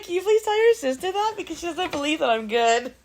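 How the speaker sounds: high, loud hammed-up plea; squealed playful begging; mock pleading. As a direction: make a show of vindication. Playful plea to her child.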